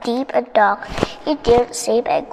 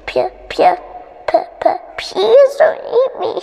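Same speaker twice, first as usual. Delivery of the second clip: stuttering start into a high, louder plea; stammered begging; 11 semitones higher, 3 dB louder than usual. A narrator's reading. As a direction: plead for your life, tripping on the P. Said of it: "begged Mis".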